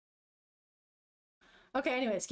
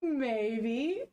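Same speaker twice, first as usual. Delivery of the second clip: drawn-out maybe with a rising lilt; acted-out coy maybe; 2 semitones lower than usual. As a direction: stretch it out, tilting up at the end. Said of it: "looking like this, and then she goes like".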